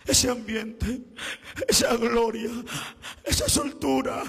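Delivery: high, loud sung-out preaching cry; full-force preaching. Acted. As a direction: preach it to the back row, chanting each phrase.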